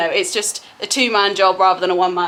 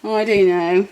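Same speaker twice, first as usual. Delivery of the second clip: ordinary delivery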